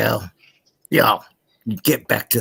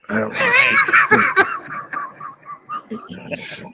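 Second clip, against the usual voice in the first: laughing shout. Real, not acted.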